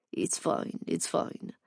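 breathy near-whisper, waving it away; hushed brush-off. A narrator's reading. Owen brushes off his own confession, hushed. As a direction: whisper it off like it's nothing.

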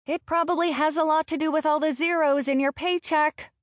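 high, quick taunting call; calling after someone. A narrator's reading. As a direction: toss it at his retreating back. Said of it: "I call behind him".

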